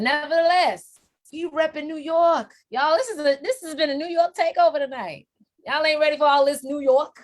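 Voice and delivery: high, loud, fast hype; rally the crowd for New York. Real, not acted. Hyping New York takeover.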